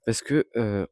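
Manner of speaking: vocal fry, just woken up; morning voice. Real, not acted. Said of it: "j'ai ma voix du matin".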